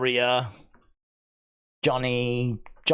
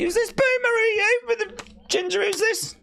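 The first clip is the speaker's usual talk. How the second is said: high, scornful jab at a stranger; mocking disbelief; 12 semitones higher than usual. Real, not acted. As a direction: sneer at the boomer, pitched up high.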